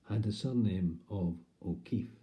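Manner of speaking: normal talking voice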